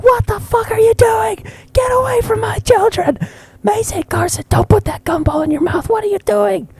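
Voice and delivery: very high-pitched imitation of outraged mom; hammed-up panicked Karen mother; mom's Karen moment. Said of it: "my mom had, like, a Karen moment".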